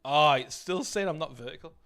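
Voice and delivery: loud exasperated exclamation; frustrated outburst. Real, not acted. Streamer to chat, annoyed the stream isn't vertical, loud. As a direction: blurt it out loud, annoyed at the software.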